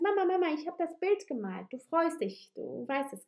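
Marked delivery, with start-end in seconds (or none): 0.0-1.7 put-on high, fast, eager little child voice; a small child rushing to show off a drawing; excited child. Child calling to mother at the kitchen table, high and quick.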